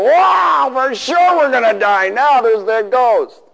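high, loud mock panic; terrified disciples. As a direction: wail like men who see a ghost. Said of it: "they see him go".

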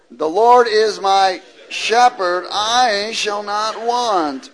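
preacher's stately scripture reading; solemn scripture reading. Real, not acted. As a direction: proclaim the verse slowly.

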